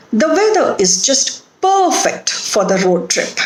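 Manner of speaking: perfect as PER-fect; stress on first syllable. Shown as a demonstration. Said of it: "First syllable is stressed".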